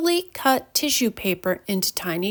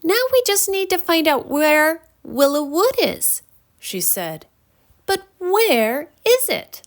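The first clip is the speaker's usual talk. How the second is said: put-on higher, bright-eyed little girl; a kind girl offering help; voiced young girl; 7 semitones higher than usual. A narrator's reading. Lisa introducing herself to fairies, eager higher voice.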